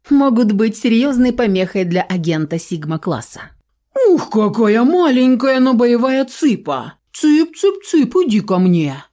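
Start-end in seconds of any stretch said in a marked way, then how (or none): 4.1-9.0 put-on creepy cooing baby talk, higher. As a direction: coo at her like a chick. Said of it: "противно засюсюкал Стэн".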